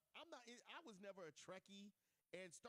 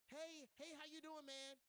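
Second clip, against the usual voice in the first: higher, light greeting; high-voiced guy; 8 semitones higher, slower than usual. A high-voiced guy greeting him, pitched up. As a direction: imitate a man with a high voice. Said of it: "when their voice is high".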